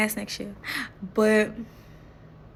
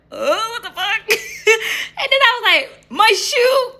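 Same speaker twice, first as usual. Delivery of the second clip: high, loud laughing retelling; can't stop laughing while quoting herself; breaking into giggles, voice up high; 13 semitones higher, 6 dB louder, slower than usual. Real, not acted. Retelling awkward meeting, laughing.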